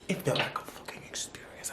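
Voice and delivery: hushed, voiceless whisper; barely-there awed whisper; confiding whisper. Retold or imitated. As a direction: whisper it like a secret. Confiding how intimate it felt.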